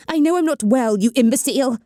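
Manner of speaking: snarled. Acted. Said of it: "Evelyn snarled".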